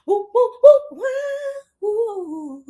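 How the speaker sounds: excited woo. Real, not acted. Celebrating the card.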